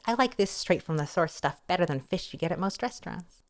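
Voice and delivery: usual speaking voice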